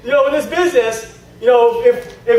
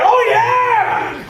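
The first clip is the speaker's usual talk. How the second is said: overexcited gush; 11 semitones higher than usual. Shown as a demonstration. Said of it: "You don't do that".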